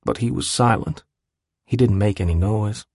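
usual speaking voice

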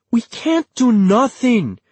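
loud, high-pitched plea; emphatic, agitated objection; urgent protest. A narrator's reading. Acted protest in family quarrel.

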